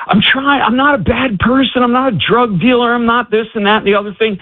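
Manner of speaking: high, pleading prayer, not quite in tears; a hint of a desperate man praying aloud; retold crying out to God. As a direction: beg God for help, lightly acted. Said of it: "he's crying out and he's saying".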